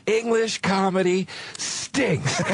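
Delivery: slow, drawn-out mock pronouncement, pitched high; imitated dismissive verdict. Comic retelling a friend's verdict on English comedy, drawn-out and dismissive. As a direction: draw out each word as a retold put-down.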